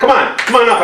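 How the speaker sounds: calling the dog up, high and coaxing; high pet voice coaxing the dog onto the couch. Real, not acted. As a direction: coax the dog up in a high sing-song voice.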